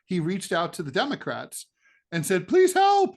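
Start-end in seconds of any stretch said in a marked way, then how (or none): 2.5-3.1 high, loud cry for help; hammed-up desperate begging; mock plea for help. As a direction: shoot up high and loud, pleading. Acted plea to the Democrats.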